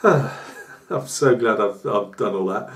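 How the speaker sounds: sarcastic sigh, then feigned gladness; mock relief. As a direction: act out weary, ironic self-congratulation. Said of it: "and you're just going".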